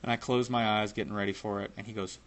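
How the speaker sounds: ordinary talk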